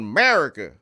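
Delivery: loud Southern twang; mock Southern accent. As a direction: punch it out in country twang. Mock Southern cry of America.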